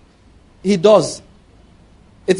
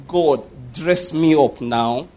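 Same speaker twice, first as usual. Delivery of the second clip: slow, lowered retold prayer; 4 semitones lower, much slower than usual. Preacher retelling a brother's car prayer, slow and low. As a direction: imitate a man praying, each word drawn out. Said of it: "he sat down and said".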